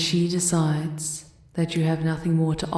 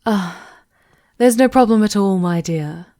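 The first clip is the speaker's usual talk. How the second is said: hurried, louder, higher brush-off; flustered cover-up; 4 semitones higher, 7 dB louder than usual. Acted. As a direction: cover the alarm with fast bright reassurance. Advisor flustered at intrusion.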